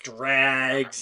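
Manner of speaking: ordinary talk, nothing marked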